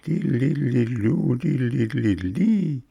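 high, light flute-like trill; imitating bright flutes. A narrator's reading. Narrator mimics distant flutes playing merrily. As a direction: sing it like a little flute. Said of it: "die hellen Flöten zu hören".

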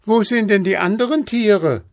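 put-on high, loud child calling up; rufend. A narrator's reading. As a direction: call up to someone high above. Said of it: "rief der kleine Häwelmann hinauf". (hoch und laut hinaufgerufen)

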